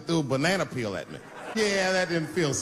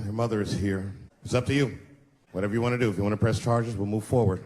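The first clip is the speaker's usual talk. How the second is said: lightly voiced cop; 5 semitones lower than usual. Police officer voiced.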